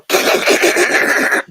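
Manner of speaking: high, unvoiced scoffing cough; mock dismissive cough. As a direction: cough a joke down like a dramatic boss. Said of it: "he would go like this".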